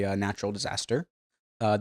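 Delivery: ordinary talk, nothing marked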